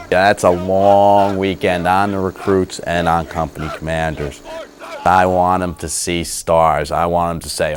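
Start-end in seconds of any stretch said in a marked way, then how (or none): none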